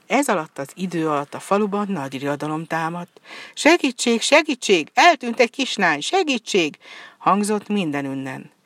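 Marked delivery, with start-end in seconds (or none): 3.5-6.8 help cries. A narrator's reading. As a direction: shout it out as alarmed villagers. Villagers crying out for help. (riadt kiáltás segítségért, magasan és hangosan)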